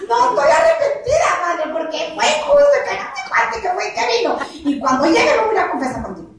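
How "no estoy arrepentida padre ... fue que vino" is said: high, fired-up insistence; excited defiance. Real, not acted. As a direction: push it out with high spirited defiance. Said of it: "a este punto quiero llegar". (desafío emocionado y agudo, sin arrepentimiento)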